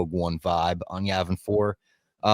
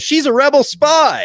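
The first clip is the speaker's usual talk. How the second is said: high, loud accusing cry of betrayal; accusing shout; 10 dB louder than usual. Retold or imitated. Double crosser pointing at the assistant, a high loud shout. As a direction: yell it out to expose her. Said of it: "points and just goes".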